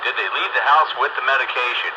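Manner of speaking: normal talking voice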